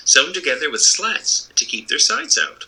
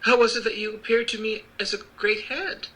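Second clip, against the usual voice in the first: put-on little girl voice; 11 semitones higher than usual. Dorothy voice.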